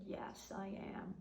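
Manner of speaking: breathy whispered reassurance; soft whisper. Retold or imitated. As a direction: whisper it to comfort her. Comforting younger friend softly.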